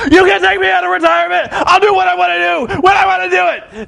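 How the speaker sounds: strut and bark back defiantly; Flair retort. Retold or imitated. Flair answering Hogan, hammed-up defiant shout. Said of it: "Ric Flair's like".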